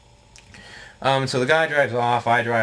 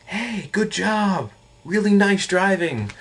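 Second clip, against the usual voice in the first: high, drawn-out sarcastic cheer; syrupy mock compliment, pitched way up; sarcastic praise; 11 semitones higher, slower than usual. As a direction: gush slowly with fake admiration. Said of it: "very sarcastically tell you off".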